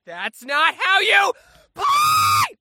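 high, loud squealing protest; hammed-up sibling tantrum; outraged kid yelling. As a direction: yell it like a child losing at a board game. Acted family board game quarrel.

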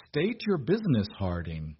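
ordinary delivery, nothing marked

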